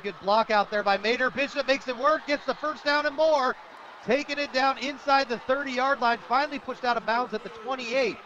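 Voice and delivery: high-pitched, rising live football call; excited play-by-play. Real, not acted. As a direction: call the run as it breaks free.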